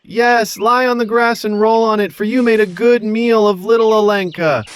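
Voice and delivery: high, mocking echo; boy taunting. Boy mocking the dragons from the treetop, high. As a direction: taunt them from the tree. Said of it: "Telesic called".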